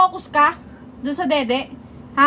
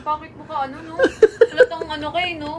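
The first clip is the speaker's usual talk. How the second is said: helpless laughter breaking up the words; cracking up while complaining; laughing scolding. Real, not acted. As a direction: try to scold through real laughter.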